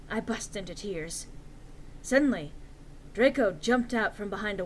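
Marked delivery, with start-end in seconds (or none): none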